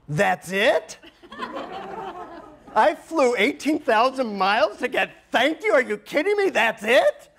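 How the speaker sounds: high-pitched, indignant disbelief climbing as it goes; comic indignant rant, voice shooting up; incredulous outrage. Retold or imitated. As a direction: protest like you've been cheated. Acts out his outrage at guru.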